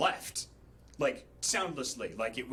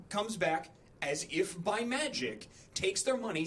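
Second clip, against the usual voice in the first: ordinary delivery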